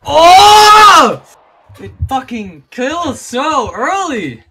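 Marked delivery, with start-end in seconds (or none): 0.0-1.2 pained yell. Real, not acted. Losing a fight.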